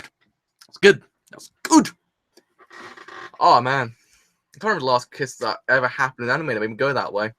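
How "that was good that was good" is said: high, squeaked-out praise; overwhelmed excitement. Real, not acted. Fan overwhelmed by the kiss, squealed excitement. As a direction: push it higher each time, drawn out.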